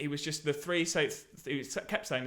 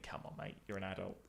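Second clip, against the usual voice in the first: inner voice; 6 semitones lower, 14 dB quieter than usual. Retold or imitated. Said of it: "And you think".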